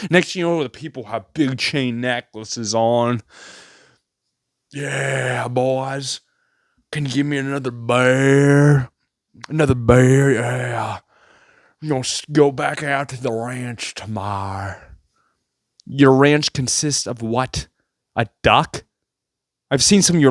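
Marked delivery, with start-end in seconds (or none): 4.7-14.8 lightly put-on rancher voice, laid back; mock cowboy drawl. Mocking wannabe cowboy at bar.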